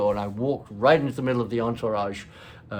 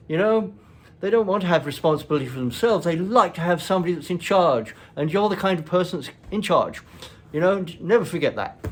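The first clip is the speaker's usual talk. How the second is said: father's firm lecture; 6 semitones higher, 5 dB louder than usual. Retold or imitated. Father lecturing child, retold.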